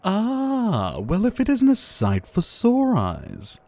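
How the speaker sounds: high, slow, delighted welcome; light up at an old friend's arrival; a high, drawn-out, pleased hello; delighted greeting. Acted. Greeting returning traveller.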